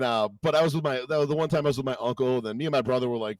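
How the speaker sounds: ordinary talk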